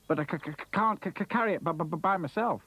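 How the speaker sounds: high, excited stutter; stammering. Acted. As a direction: trip over each first sound. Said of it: "he stammered".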